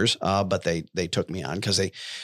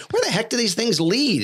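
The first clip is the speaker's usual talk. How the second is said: high, puzzled exclaim; baffled wonder; 12 semitones higher than usual. Retold or imitated. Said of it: "I'm like".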